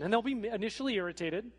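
ordinary talk

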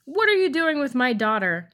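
buzzing voice. Acted. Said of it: "asked the buzzing voice".

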